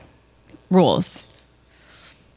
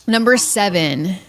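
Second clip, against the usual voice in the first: ordinary talk, nothing marked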